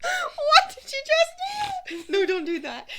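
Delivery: very high, loud, drawn-out laughing shriek; shocked squeal. Real, not acted. Reacts to friend's sudden antic.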